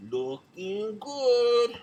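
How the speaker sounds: lightly drawn-out imitation of "Looking good", slow and savoured; slow, drawn-out "Looking good" in a hint of an accent; Freddie Prinze catchphrase. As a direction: stretch "Looking good" out slowly, a light nod to the comedian. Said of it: "He said it better than I did".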